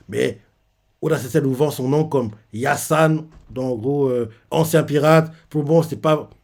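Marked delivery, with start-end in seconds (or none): none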